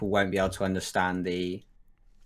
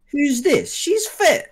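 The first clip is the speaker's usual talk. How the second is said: high, louder burst of astonished approval; mock exclaimed reaction; 6 dB louder, much slower than usual. Retelling how a mate reacted to the photo, mock exclaimed reaction. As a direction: pitch it way up, impressed and intrusive. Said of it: "looked at me and just went".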